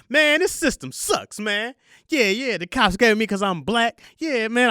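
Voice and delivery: high, slow, whiny complaint; blaming everyone. Retold or imitated. First night in jail blaming the system, mock whiny gripe. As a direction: gripe like everyone's out to get you. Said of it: "I was blaming everybody but myself".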